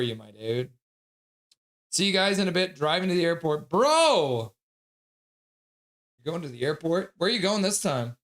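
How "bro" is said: loud, high surprised "Bro!"; hey bro shout; excited bro. Real, not acted. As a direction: blurt it out in surprise. Reacting loudly to airport trip.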